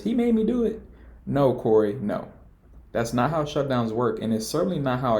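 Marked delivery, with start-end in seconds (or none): 0.0-0.8 mock kid voice. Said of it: "when a kid breaks a vase ... and says".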